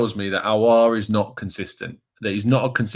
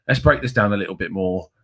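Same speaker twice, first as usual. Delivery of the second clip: ordinary talk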